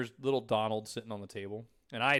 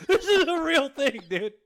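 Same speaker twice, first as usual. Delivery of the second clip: high, louder and slow, laughing; 12 semitones higher, 8 dB louder than usual. Real, not acted. Convincing his cohost the duck is real, laughing. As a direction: insist it's true while laughing.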